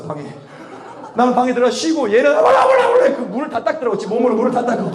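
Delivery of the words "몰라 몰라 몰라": very high, loud babble; mock tongues babble. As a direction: squeal it like tongues. (방언 흉내를 아주 높고 크게)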